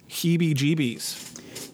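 heebie-jeebies drawn out slowly; slow careful heebie-jeebies. Shown as a demonstration. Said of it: "it really gives me the heebie-jeebies".

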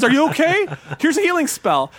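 high, fussing parent voice; a dad fussing over his son, pitched high; helicopter parent. Retold or imitated. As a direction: coo at the kid like an overprotective parent. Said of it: "Sounds like a helicopter parent".

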